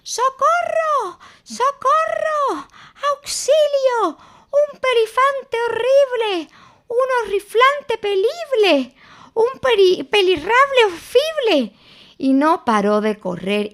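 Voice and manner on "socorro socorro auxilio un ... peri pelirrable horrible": very high, slow, loud shrieking, words tumbling; screaming for help. A narrator's reading. Piglet running home screaming, frantic shriek. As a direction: yell nonstop, so scared the words jumble. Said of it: "gritando sin parar".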